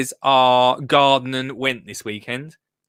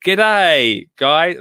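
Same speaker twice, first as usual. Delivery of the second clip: a cheery mock Australian G'day, a bit louder; bad Australian accent greeting the viewers; 5 dB louder than usual. Host greeting Australian viewers in a mock Australian accent. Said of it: "destroy the, their accent".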